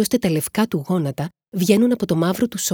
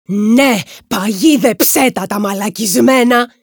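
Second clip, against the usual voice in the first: loud, slow, raised-pitch cheer; loud exclamation; 5 semitones higher, 8 dB louder, slower than usual. A narrator's reading. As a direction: call it out with fierce relish, drawn out. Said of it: "λέει φωναχτά". (δυνατή φωνή, αργά και τραβηχτά)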